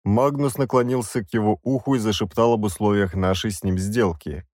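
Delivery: ordinary delivery, nothing marked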